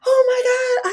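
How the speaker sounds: high, stunned exclamation; overwhelmed amazement. Retold or imitated. She is stunned by the room's affirmation, a high awed exclamation. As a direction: let the awe spill out, pitched high.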